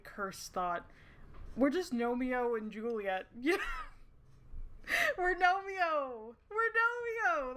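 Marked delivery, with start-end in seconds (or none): 5.2-7.6 high, slow, thrilled shouting of the name; excited shout. Real, not acted. Gamer shouting in delight at the characters' name, high and slow. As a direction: yell it out in delighted disbelief. Realizes their silly shared name.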